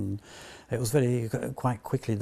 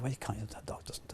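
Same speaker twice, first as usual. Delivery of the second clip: retold whisper; 2 semitones lower, 14 dB quieter than usual. Retold reply to the voice actor.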